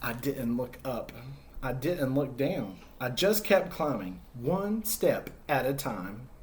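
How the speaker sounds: put-on storytelling to kids as Humpty, lively and expressive; story narration. Teacher reading Humpty's story to children, expressive narration. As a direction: read it like a picture book to a class. Reads picture book to children.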